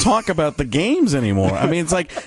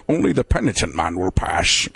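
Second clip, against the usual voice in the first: Sean Connery voice; 6 semitones lower than usual. Retold or imitated. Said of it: "Sean Connery Voice Club".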